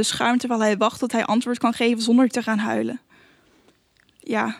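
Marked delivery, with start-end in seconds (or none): 4.2-4.6 soft, whispered yes; a small, hushed reply. A narrator's reading. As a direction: answer under your breath, softly. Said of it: "fluistert hij".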